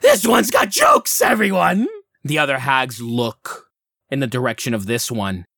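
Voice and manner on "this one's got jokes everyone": high, loud hag screech; cackling hag. Acted. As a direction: crow it to the coven, high and mocking. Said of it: "As the one hag says".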